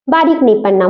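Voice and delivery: ordinary talk, nothing marked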